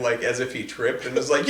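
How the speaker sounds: normal talking voice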